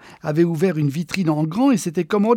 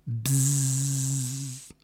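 dial tone buzz; 2 semitones lower, 4 dB quieter than usual. Acted. The empty phone line buzzing back at him.

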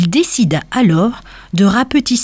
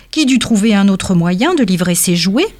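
ordinary delivery, nothing marked

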